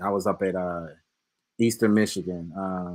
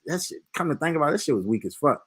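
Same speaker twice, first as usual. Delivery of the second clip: usual speaking voice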